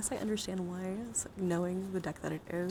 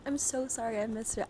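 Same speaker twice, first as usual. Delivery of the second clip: ordinary talk, nothing marked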